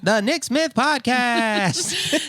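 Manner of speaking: high, drawn-out title announcement; playful show announcer. Pretend. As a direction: stretch the podcast title out slowly and high. Show title in mock announcer intro.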